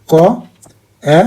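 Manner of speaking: ক and এ as separate sounds, paused between; speech sounds demo. Shown as a demonstration. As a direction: sound out ক, then এ, one at a time. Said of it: "এটাকে আমরা কি বলি?". (ধ্বনি ভেঙে ভেঙে ক, এ উচ্চারণ)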